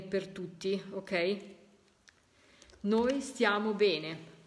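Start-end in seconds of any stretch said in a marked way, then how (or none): 2.8-4.3 slow, weight on noi; slow repeat. Shown as a demonstration. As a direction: spell the message out gently. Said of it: "Vedete quando i messaggi". (lento, accento su noi)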